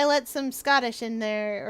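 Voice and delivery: normal talking voice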